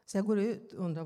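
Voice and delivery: ordinary talk, nothing marked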